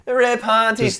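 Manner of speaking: high, loud mock Irish accent. Host taking over co-host's bit, mock Irish accent. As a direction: throw out a big, high Irish impression. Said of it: "Irish accent serious".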